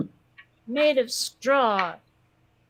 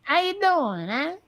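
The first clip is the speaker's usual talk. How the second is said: higher, hammed-up New York greeting; New York accent; 4 semitones higher than usual. Shown as a demonstration. Showing the learner a New York accent. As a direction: throw it out like a New Yorker. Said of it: "be taught how to do this correctly".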